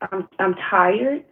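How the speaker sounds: ordinary talk, nothing marked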